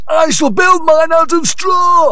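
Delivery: high, loud little pig voice; a squeaky first pig boasting. Acted. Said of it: "said the first pig".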